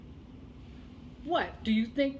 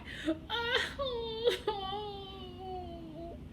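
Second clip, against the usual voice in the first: put-on baby crying; 8 semitones higher, 6 dB quieter than usual. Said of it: "he continued to cry".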